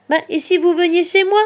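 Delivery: put-on high, loud, eager child-like offer; little hedgehog. A narrator's reading. Hedgehog voiced, offering a home. (petit hérisson à la voix aiguë)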